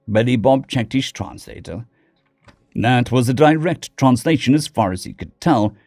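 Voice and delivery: ordinary delivery, nothing marked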